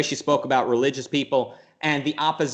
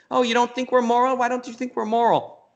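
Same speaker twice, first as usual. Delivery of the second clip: mocking pleading scientist; 11 semitones higher than usual. [name] mocking scientists begging, high whiny plea. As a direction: whine for validation. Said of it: "I can't do her accent right now".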